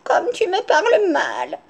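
high, slow whimper; hammed-up weepy granny; tearful reproach. A narrator's reading. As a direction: whimper it, drawn out and wounded. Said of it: "se mette à pleurer et lui dise".

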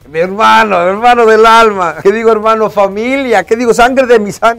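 cada vez más agudo y fuerte, halago exagerado; efusivo irónico. Pretend. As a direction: sube el entusiasmo hasta el ridículo. Alabando a YouTube con ironía. (halago exagerado que crece)

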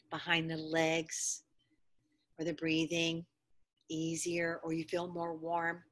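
hushed guided relaxation for students lying down; soft relaxation voice. Acted. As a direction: ease them into rest gently. Relaxation cues while students rest.

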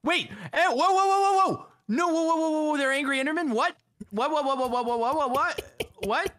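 high, loud disbelief; shocked rapid-fire yelling. Real, not acted. Sees angry Endermen appear.

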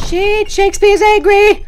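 high, loud alarmed shout; panicked shout. Real, not acted. Frightened streamer shouting as the dad gets angry. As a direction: yelp it in a panic.

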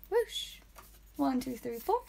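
ordinary talk

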